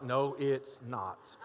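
wife's retort. Retold or imitated. Wife contradicting husband at church door, slow and low. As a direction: flatly correct him. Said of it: "your wife's like".

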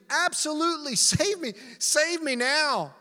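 high, urgent shouted plea; desperate pleading. Acted. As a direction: beg loudly to be rescued at once. Dangling man begs to be saved.

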